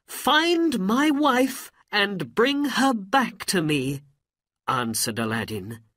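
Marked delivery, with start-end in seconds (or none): 0.1-4.0 high, loud, slow plea; urgent request. A narrator's reading. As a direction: implore the genie in a raised voice. Aladdin begs the Jinni.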